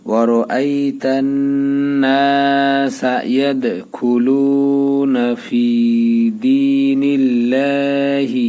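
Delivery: slow melodic chant with long held vowels; Quran recitation. Shown as a demonstration. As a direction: recite the verse slowly and melodiously. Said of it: "এখন মিলে আমরা".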